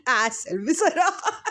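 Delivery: high laugh spilling into slow words; real laughter. Real, not acted. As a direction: laugh it out, then say it slowly. Laughing at the video. (ضحك حقيقي ثم كلام بطيء)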